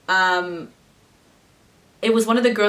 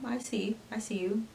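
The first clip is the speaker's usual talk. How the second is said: hushed; 11 dB quieter than usual. Retold or imitated. Inner thought, hushed.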